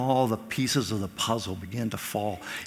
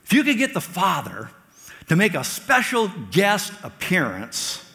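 higher and louder, a bit pushy and naive; a hammed-up disciple pleading for a sign; eager, naive Philip; 6 semitones higher, 5 dB louder than usual. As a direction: ask like a clueless friend wanting proof. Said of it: "And so he says".

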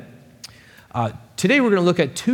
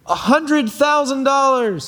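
much higher, slow, all-in announcement; announcing the bid; 11 semitones higher than usual. Acted. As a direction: lay down your last dollar. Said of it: "he announces".

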